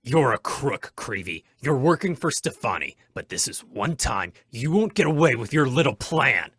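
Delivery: loud, rising accusation; angry accusation. Acted. Hornet accusing Creevy of working for Stefani, loud. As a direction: nail him with it.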